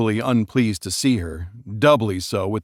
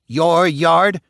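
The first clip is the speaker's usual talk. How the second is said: higher, louder outraged echo; indignant retort; 7 semitones higher, 6 dB louder than usual. Acted. Wife scoffing at her husband, outraged. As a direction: throw his word back at him.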